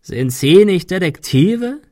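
high, loud, slow croaked question; drawn-out raspy villain question; croaking. A narrator's reading. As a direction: croak it out mockingly. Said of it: "krächzte er".

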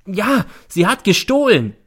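high, loud justification; defiant retort. A narrator's reading. Mother defending herself against son, defiant. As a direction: snap back defensively. Mother hits back in quarrel.

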